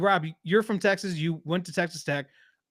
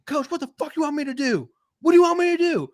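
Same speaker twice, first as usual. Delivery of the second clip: high-pitched mock yell, building louder; retold angry confrontation; 11 semitones higher, 6 dB louder, faster than usual. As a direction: imitate an exasperated coach yelling back in someone's face. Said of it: "gets back in his face".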